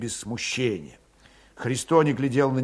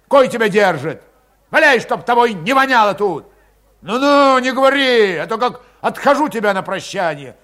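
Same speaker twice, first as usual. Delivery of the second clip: loud, rising, raging threat; Grigory barely holding back a blow, furious shout; 8 semitones higher, 7 dB louder than usual. A narrator's reading. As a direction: tear into him, louder and higher.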